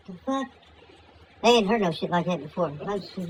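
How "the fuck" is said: high, hushed disbelief; quiet, comic bewilderment; startled Moses. Acted. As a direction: mutter it softly, pitched up in bafflement.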